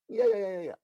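loud, high burst of yeahs; excited yeahs. Real, not acted. Preacher rejoicing before the church, loud excited yeahs. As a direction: erupt with joy over the word. Preacher exults over the scripture.

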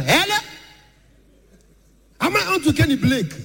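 high-voiced Enugu caller. Enugu man phoning the comedian, high voice. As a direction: squeak out the greeting.

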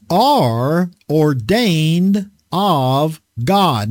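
very slow, deliberate scripture reading. Real, not acted. Preacher reading the apostle's words to his listeners, slowly and deliberately. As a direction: read it word by word, letting each one sink in. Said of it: "I wanted to read that slowly".

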